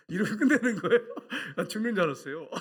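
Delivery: telling it through real laughter; loud, high, breaking into laughter; laughing. Real, not acted. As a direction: crack up while finishing the story. Preacher laughs telling the climax.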